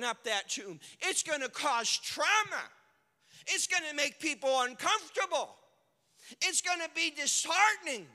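loud, high shouted sermon; preacher roaring at the congregation; full-force preaching. Real, not acted. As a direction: build and hammer each line.

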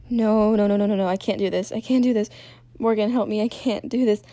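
loud, rushing desperate refusal; panicked pleading. Acted. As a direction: cry out for help in panic. Narrator's character panics, begs for help.

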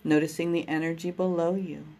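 ordinary talk, nothing marked

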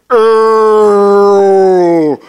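a friend's scream replayed in slow motion; slow-motion cry. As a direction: stretch it out as in a slowed-down film. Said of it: "This was one of those slow motions".

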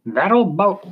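put-on high, questioning old French sailor; French-accented captain, pitched well up; French sea captain. A narrator's reading. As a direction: query it as a French old salt. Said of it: "His French accent was thick".

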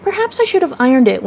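ordinary delivery

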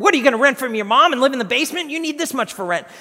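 fast, high, loud mock scolding; gruff boss. Boss ridicules employee's rent estimate, gruffly incredulous. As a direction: rattle off incredulous objections. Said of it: "he's just gruff".

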